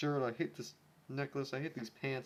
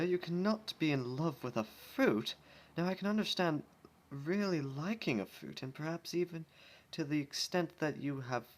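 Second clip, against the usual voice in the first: higher, prim scolding lady; posh Rarity voice; 6 semitones higher than usual. Acted. Rarity scolds Rainbow for loving a fruit, prim. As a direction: fuss over her like a proper lady. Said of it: "Rarity exclaimed".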